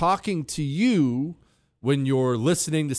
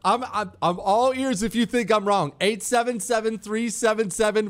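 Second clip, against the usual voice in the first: ordinary talk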